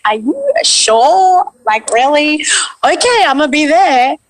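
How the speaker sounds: high-pitched, thrilled re-enactment; excited disbelief. Retold or imitated. Actor retelling her answer to the callback, excited disbelief. Acted reply to callback news.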